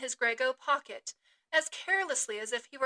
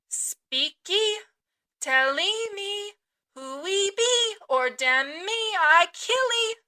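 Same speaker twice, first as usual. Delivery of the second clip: slow, loud, higher cannibal threat; growled threat; 6 semitones higher, 6 dB louder, slower than usual. Acted. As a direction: bellow the threat with the tomahawk raised. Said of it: "again growled the cannibal".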